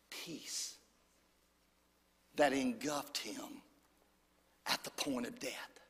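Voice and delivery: whisper. Acted. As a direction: let the words fade into a breath of peace. Preacher hushes for the dying peace.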